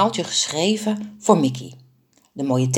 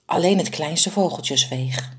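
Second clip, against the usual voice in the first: ordinary delivery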